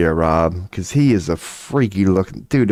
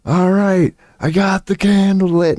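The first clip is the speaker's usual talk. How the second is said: higher, called out from across the room; mock call from the corner, pitched up; yelling back; 11 semitones higher, slower than usual. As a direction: shout it back to the others from a corner. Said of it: "yelling back".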